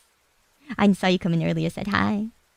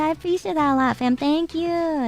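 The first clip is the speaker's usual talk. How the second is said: squeaky high-pitched thank-you, much higher; cute high voice; 9 semitones higher than usual. Acted. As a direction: thank them in a tiny cute voice.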